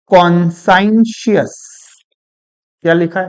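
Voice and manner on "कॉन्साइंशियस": कॉन्साइंशियस slowly, "kon-sine-shus"; read as written. Shown as a demonstration. Teacher reads the written word out, कॉन्साइंशियस slowly as kon-sine-shus. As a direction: stretch कॉन्साइंशियस syllable by syllable with a long sine. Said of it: "तो लिखा है".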